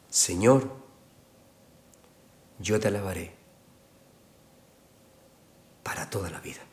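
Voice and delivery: soft, low prayer fading to a whisper; hushed prayer. Pretend. Preacher models a prayer, soft and hushed. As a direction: pray it quietly to the Lord.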